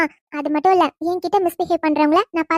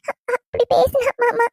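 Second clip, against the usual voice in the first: tearful; 5 semitones higher than usual. Acted. Tearful after retelling insult.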